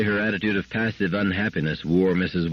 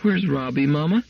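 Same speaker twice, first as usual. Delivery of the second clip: on the verge of tears; 7 semitones higher than usual. A narrator's reading. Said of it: "voice quavered on the verge of tears".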